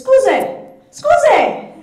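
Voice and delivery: high, loud Scusi! Scusi! called to the shopkeepers; calling out Scusi. Shown as a demonstration. In a Roman shop, calling loudly for attention. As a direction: call Scusi! across the shop to get noticed. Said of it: "your most important phrase is".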